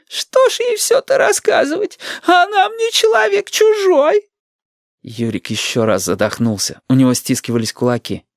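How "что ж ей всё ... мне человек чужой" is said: put-on piercingly high, slow old-woman voice; high granny whine; querulous grandma. A narrator's reading. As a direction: complain in a drawn-out, very high granny voice. Grandmother retorts in quarrel.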